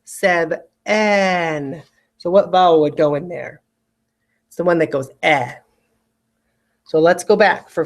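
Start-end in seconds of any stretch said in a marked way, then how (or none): 0.0-1.8 "seven" with a clear "eh" in "ven", drawn out; spelling pronunciation. Shown as a demonstration. Teacher says the word the way it is spelled. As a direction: stretch "seven" so both E's ring. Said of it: "So I want you to say to spell seven".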